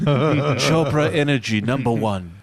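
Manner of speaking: put-on slow African accent, announcer read; African-accented ad pitch. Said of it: "doing an African voice for an Indian guy".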